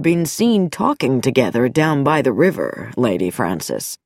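a calm doctor hiding something; sinister doctor. Acted. Dr Nicholson voiced.

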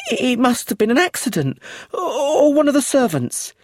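grasping at excuses, close to crying; tearful stammer. A narrator's reading. Accused widow to inspector, tearful and stammering. Brenda near tears.

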